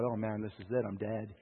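soft, low resigned murmur of doom; hushed dread. Retold or imitated. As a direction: say it as if already given up. Fearing the huge angry man.

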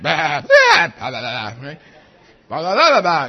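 loud mock bleats, an argumentative sheep; sheep bleating. Preacher bleating like a sheep arguing with its shepherd. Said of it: "sheep just arguing with the shepherd".

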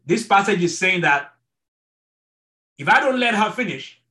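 ordinary talk

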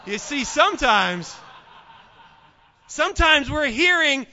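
loud and high, hammering the point; emphatic preaching. Real, not acted. Pastor driving home the lesson after the joke, loud. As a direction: laugh then preach it hard.

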